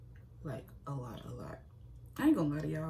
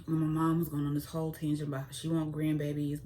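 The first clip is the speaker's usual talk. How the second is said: ordinary delivery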